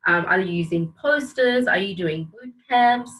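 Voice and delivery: ordinary talk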